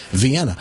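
VIENNA as "vee-EN-uh"; learned standard pronunciation. Shown as a demonstration. Host showing he has learned, standard "vee-EN-uh" Vienna. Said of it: "I don't do that anymore".

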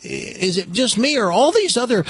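high, slightly hushed bewildered cabbie; puzzled cab driver. Acted. Cab driver asking the passenger behind him, high puzzled. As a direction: wonder aloud, half suspicious. Punchline, driver's confused question.

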